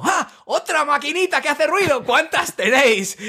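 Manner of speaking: high, slow, drawn-out sarcastic yell; mock shouting. Shouting alone at builders through the extractor, mock outrage. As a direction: call out indignantly so they might overhear. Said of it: "me pongo a gritar yo solo".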